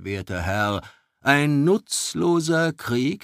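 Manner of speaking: a lightly voiced baron, mocking and challenging. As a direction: press the elf with scornful questions. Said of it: "blickte den Elf herausfordernd an". (Freiherr fordert den Elf spöttisch heraus)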